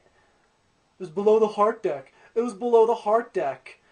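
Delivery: loud, drawn-out taunting mimicry; mocking tattletale. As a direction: parrot the tattler's line back mockingly.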